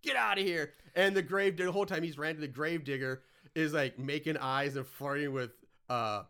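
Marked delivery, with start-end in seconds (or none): 0.0-0.7 high, indignant retold tirade; mock angry rant. Hitler scolding the grave digger, high mock fury. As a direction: rant like a hammed-up villain scolding underlings. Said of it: "And he gets mad".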